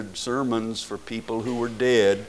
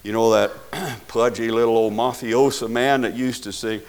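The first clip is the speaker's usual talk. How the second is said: ordinary delivery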